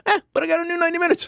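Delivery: very high, fast squeaky voice; giddy squeal of the punchline; silly triumphant punchline. Acted. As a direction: chirp out the upside. Punchline upside.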